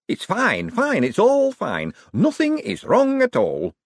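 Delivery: very high, drawn-out panicky insistence; strained, rattled denial; frantic reassurance. A narrator's reading. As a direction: insist too hard that all is well. Frantic insistence that all is fine.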